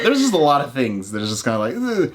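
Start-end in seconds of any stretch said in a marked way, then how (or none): 1.8-2.1 high, squeamish groan; 高音嫌弃地哼; disgusted ugh. Retold or imitated. Squeamish reaction.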